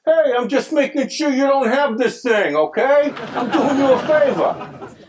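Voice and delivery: high-pitched, loud comic protest; indignant husband. Acted. Husband defends his Dutch oven to his wife, loudly indignant. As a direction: yell it in defensive, strained high voice.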